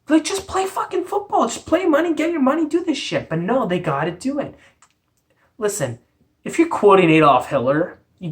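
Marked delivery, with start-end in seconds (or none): 0.1-3.3 pitched way up in exasperation; exasperated. Real, not acted. Host telling players to stick to football, exasperated. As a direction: plead in a high, incredulous rant.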